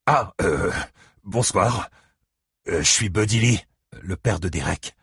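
halting, embarrassed introduction; awkward, hesitant. A narrator's reading. Uninvited visitor introducing himself to homeowner, hesitant. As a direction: stumble into introducing yourself.

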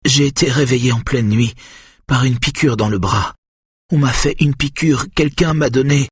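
high, frail woman's voice; distressed account. A narrator's reading. Patient tells husband of injection, high and distressed.

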